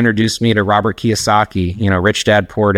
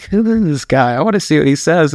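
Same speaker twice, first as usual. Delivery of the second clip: mock-wary, pitched high; wary aside; 6 semitones higher than usual. Storyteller acts out eyeing a stranger, high and wary. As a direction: raise the pitch as if privately puzzled.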